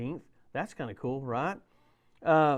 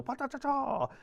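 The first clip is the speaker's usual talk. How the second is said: grill sizzle noise; 11 semitones higher than usual. Retold or imitated. Imitating the hibachi grill sizzle.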